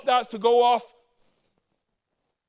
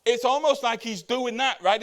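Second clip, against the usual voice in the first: ordinary delivery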